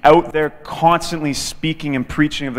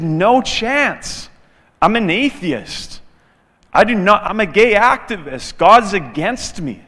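higher, louder acted protest; indignant refusal; 5 semitones higher, 5 dB louder than usual. Atheist refusing his friend's question about Jesus, indignant protest. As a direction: push back hard, imitating an affronted man.

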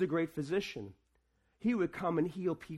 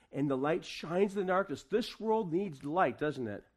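raised, ringing sermon voice; preaching; 2 semitones higher, 4 dB louder than usual. Acted. Pastor preaching to the church at Christmas, ringing and raised. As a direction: preach it out to the congregation.

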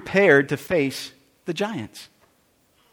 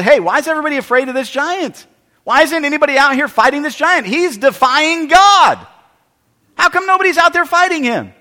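higher, louder, pressing questions; a boy demanding to know why nobody fights; indignant challenge, higher and louder; indignant young David; 6 semitones higher, 5 dB louder than usual. Acted.

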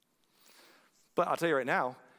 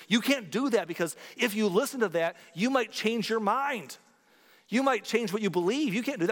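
mock scolding critic; 6 semitones higher, 3 dB louder than usual. Mocking critics who forbid listening.

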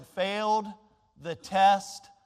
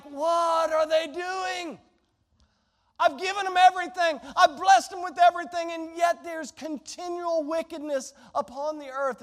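exasperated God; 7 semitones higher, 3 dB louder than usual. Retold or imitated. God exasperated at his people, higher and louder. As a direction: cry out like a grieved father who gave everything. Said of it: "He's like".

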